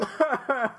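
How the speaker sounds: high, loud fake wailing; mock crying. Scared plumber sits down and cries, mock wailing. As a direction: imitate a cartoon character bawling. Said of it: "sit down and cry".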